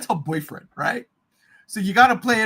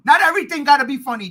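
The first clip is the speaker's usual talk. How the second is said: quick, louder complaint; fast plea; 3 semitones higher, 6 dB louder, faster than usual. Real, not acted. Host addressing James Gunn, fast and exasperated. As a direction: rattle off the complaint. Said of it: "pleading to James Gunn".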